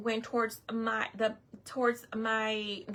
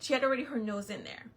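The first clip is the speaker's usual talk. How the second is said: ordinary talk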